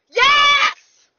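high, loud victory yell; triumphant shout. Real, not acted. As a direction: scream it out in triumph. Wins the timed game.

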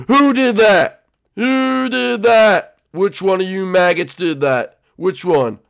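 high, loud, drawn-out demand; angry drill sergeant. Acted. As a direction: roar at the recruits for the culprit. Sergeant demands culprit from recruits.

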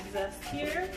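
normal talking voice

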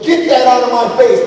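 put-on high, loud bratty child voice. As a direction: snap it like a sassy toddler. Defiant daughter voiced.

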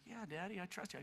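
quiet, trusting little answer; hushed imitation of a trusting son; soft child's reply. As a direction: answer Dad very softly. Small son answers father softly.

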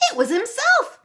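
high, loud triumphant crow. A narrator's reading. Auntie Moira crowing to the kids about the leprechaun. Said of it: "crowed Auntie Moira".

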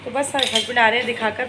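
normal talking voice

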